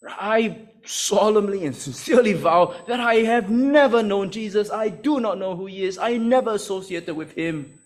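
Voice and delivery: high, emphatic sworn denial; vehement oath. Acted. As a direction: swear it forcefully, as if under oath. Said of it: "he just began to use very strong words, vehemently".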